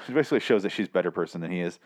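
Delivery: ordinary delivery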